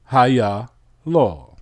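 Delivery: Haya, law in three parts, HA-YA then LAW; word sounded out. Shown as a demonstration. Teacher puts the syllables together for the class, sounding out the word. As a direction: join the syllables but keep a break before law. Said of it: "and what do you have?".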